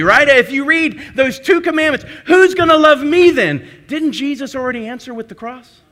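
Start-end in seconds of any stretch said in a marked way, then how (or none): 2.3-3.7 higher, louder pleading question; loud, high mock plea; anxious inner cry. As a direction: cry out like a worried heart afraid of being left unloved. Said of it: "a part of us there that wants to go".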